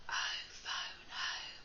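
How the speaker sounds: soft whispered alien. Pretend. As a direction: breathe it like a tiny alien. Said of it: "my alien voice for this one".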